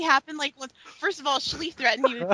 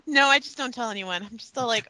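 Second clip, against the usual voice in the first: normal talking voice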